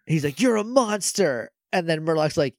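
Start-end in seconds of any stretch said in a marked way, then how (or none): 0.4-1.5 mock accusing. Sky accusing villain.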